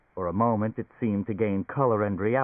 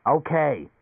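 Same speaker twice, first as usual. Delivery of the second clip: a gruff, higher, louder okay; rough; 6 semitones higher, 5 dB louder than usual. Acted. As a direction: bark it out roughly. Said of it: "Leo said roughly".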